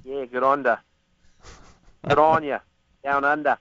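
Aussie impression with dropped r's; imitated Australian accent. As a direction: put on a playful Australian accent.

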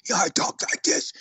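airy whispered rasp of a throat-punched trainer; imitating a voice box punched in boxing; damaged voice box rasp. Retelling his old trainer, mimicking the injured whispery voice. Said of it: "So he talked like this".

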